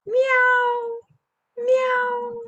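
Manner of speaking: high short cat meows; playful high cat meow. Shown as a demonstration. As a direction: mew high and bright like a cat. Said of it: "that's the sound of a cat".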